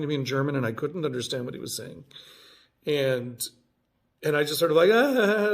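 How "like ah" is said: loud, high embarrassed laugh; flustered nervous laugh; awkward laugh. Real, not acted. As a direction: laugh off the confusion. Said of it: "laughed, ah".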